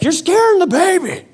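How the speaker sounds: high-pitched mock outburst; wife's alarmed scolding. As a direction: exclaim it high, imitating an upset wife. Voices his wife's scolding.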